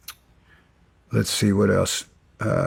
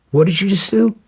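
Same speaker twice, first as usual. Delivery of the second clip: much higher, incredulous demand; escalated retold confrontation; 10 semitones higher, 3 dB louder than usual. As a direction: repeat the question in high-pitched outrage.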